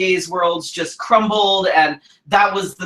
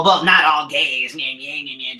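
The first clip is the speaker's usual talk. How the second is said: mocking whiny voice; 2 semitones lower than usual. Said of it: "so don't go all".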